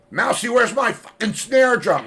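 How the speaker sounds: high, loud comic outburst; loud, high-pitched jokey holler; joking angry shout. Acted. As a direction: yell it out as a mock demand. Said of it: "He said".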